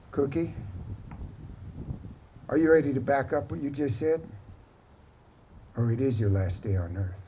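understated menace, sinking at the end; quiet threat. Acted. Vaquero threatens Cookie up close.

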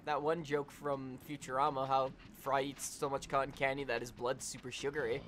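ordinary delivery

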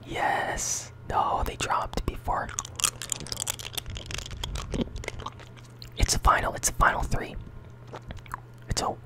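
whispering with a mouthful of jawbreakers; sucking candy. Real, not acted. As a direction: talk around candy in your mouth. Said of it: "I can't even really talk like this".

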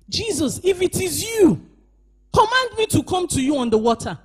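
high, sung call of Peter across the water; a touch of sung pleading as Peter. Acted. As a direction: call out to Jesus in half-song. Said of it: "And Peter answered him and said".

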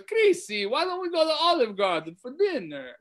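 Chrissy sung out high and sweet, like a doting Italian mother; high sweet mom voice. Retold or imitated. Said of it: "she goes".

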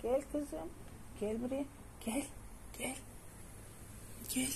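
high, soft rising call; coaxing call. Real, not acted. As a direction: coax her over. Kediyi çağırıyor. (ince sesle çağırma)

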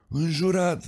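lightly voiced old miner; high sheepish admission. A narrator's reading. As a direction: own up with a shrug in the voice. Miner admits swearing.

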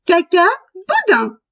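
high little rabbit voice; cheeky little rabbit. A narrator's reading. Little rabbit answering the wolf, high cheeky voice. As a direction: pipe it up high, then drop at the end.

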